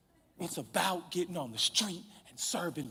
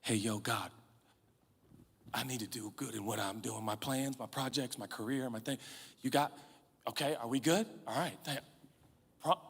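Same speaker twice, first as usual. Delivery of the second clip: low, offhand mumbled prayer to God; casual prayer; 4 semitones lower, 6 dB quieter than usual. Retold or imitated. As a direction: toss off a lazy, hurried prayer. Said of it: "doing what we all do".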